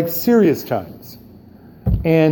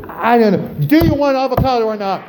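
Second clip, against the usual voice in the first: exasperated; 6 semitones higher than usual. Retold or imitated. Exasperated reply.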